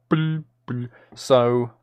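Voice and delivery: ordinary delivery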